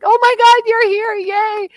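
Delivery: squealing high and loud at arriving guests; happy host welcoming a friend; excited greeting. Retold or imitated. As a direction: gush like the host spotting a friend at the door. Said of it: "you're, like, so excited that they've arrived".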